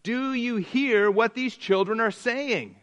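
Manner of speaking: acted outrage, slow and pointed; indignant. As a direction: demand it slowly, scandalized. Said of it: "they became indignant and said to him".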